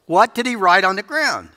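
preacher teasing the big question; eager question. Acted. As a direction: lean in with excitement. Rhetorical question to congregation.

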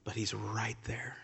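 whispered assurance; hushed, intimate reassurance. Real, not acted. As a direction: drop to a whisper, he's near. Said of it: "sudden whisper in sermon".

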